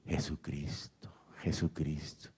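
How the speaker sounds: hushed, drawn-out mock shout; retold cry of praise. Preacher retells an old man's jumping praise, as a hushed cry. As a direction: shout it under your breath, slowly.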